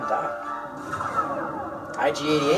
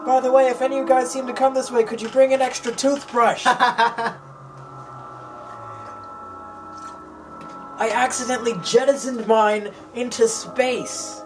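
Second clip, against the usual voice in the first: mock Obi-Wan; 7 semitones higher than usual. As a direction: play the earnest Jedi calling home. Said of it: "he's like".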